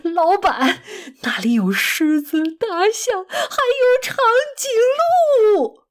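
high-pitched, gleeful outburst; excited. A narrator's reading. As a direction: gush with delight, voice climbing high. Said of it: "达尔兴奋地说". (兴奋地高声欢叫)

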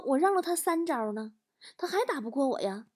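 high, soft mock-wronged protest; aggrieved child. Child protests to dad after a slap, acted grievance. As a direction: plead innocence in a small voice. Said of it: "我也很委屈啊".

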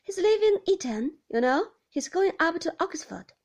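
high and loud, still calling out; shouting. Acted. Beatrice keeps shouting news of Roger to Gran. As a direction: keep projecting for the deaf old lady. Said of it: "shouted Beatrice".

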